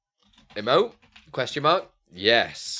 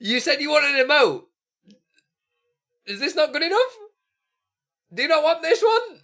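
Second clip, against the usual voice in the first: amused teasing; 11 semitones higher than usual. Real, not acted. Teasing viewer about the emote.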